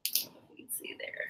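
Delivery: soft unvoiced whisper. Real, not acted. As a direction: whisper it to yourself. Whispering to self while looking.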